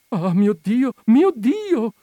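high, loud, building wail; frightened whimpering. A narrator's reading. Frightened lady clutching a little girl, whimpering loudly. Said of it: "piagnucolava una".

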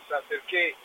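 ordinary talk, nothing marked

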